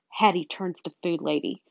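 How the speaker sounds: plain reading voice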